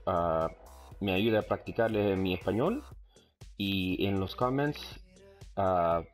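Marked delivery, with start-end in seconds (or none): none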